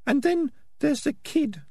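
very high, slow little female rat voice; squeaky falsetto character voice; prim Peaches. Acted. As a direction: speak high up in falsetto, measured.

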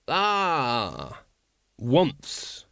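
drawn-out, languid greeting; unruffled ruler. Acted. Patrician greets the fleeing Wonse.

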